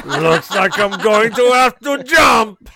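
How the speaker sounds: slow, loud, high proclamation; hammed-up drawn-out shout; mock dramatic shout. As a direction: belt it out slowly in hammed-up drama. Performs line before hotline routine.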